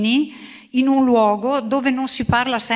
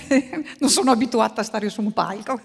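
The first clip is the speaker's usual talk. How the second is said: nervous laugh; faster than usual. Real, not acted. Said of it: "scusate l'emozione".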